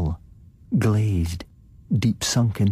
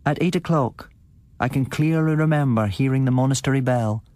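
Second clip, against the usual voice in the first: higher woman's voice; wife voice; 7 semitones higher than usual. A narrator's reading. Wife answers.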